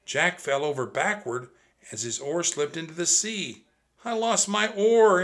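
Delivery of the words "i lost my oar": high, loud panicked yell. A narrator's reading. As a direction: shout it over the storm. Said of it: "Annie yelled".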